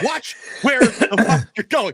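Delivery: high, loud, slow angry snap; annoyed yell. Retold or imitated. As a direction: tell them off for bumping into you. Snapping at people bumping into him.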